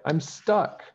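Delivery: high, worried realisation, not yet shouting; the maid finds herself trapped up the bed; dismayed. A narrator's reading. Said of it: "Peg cried".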